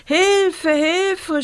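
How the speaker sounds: high panicked cries for help; screaming for help. Acted. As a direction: yell it, terrified child. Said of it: "schrie Rosa".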